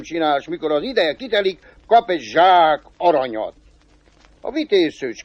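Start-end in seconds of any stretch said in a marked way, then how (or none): none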